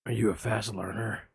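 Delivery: lurk low like a shady caller; creepy caller. Retold or imitated. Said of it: "I'm gonna play both parts".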